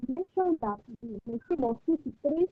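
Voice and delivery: faint, fading voice; too quiet to hear on the call; barely audible. Real, not acted. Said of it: "Your voice is very low".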